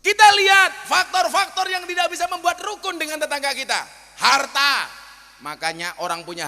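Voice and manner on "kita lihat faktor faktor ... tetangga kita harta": loud, high sermon voice; preaching. Acted. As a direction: preach it out to the crowd. Preaching at full force. (ceramah berapi-api dengan suara keras tinggi)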